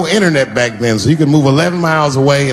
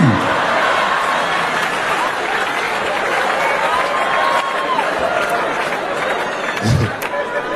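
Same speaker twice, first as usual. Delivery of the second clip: long high wail; 5 dB quieter than usual. Acted. Comic wail.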